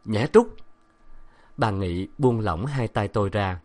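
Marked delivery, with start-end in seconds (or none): 0.1-0.6 louder, sharp call of her name. Acted. Professor calling his wife to snap her out, loudly. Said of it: "Ông lớn tiếng gọi".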